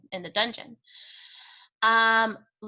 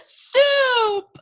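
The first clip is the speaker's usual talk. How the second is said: much higher, louder outburst of the forbidden word; shouted; 7 dB louder than usual. Acted. Cook shouting the forbidden word at Louise, loud and high. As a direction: hurl the word out defiantly. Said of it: "shouted Cook".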